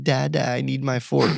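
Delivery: lightly voiced needy child; imitated young daughter. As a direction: mimic a small daughter asking dad. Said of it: "she's like".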